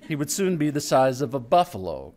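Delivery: plain reading voice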